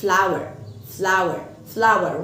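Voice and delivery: "flower, flower" slow and even; slow demo. Shown as a demonstration. Teacher modelling flower and flour. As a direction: say both "flower" alike, slowly. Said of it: "وكلمة flower دي".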